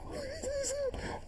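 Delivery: a short burst of high laughter; real laugh. Real, not acted. Remembering asking his mother, a high real laugh. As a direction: laugh out at the memory.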